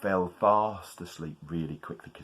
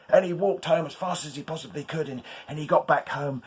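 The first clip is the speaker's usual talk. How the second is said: usual speaking voice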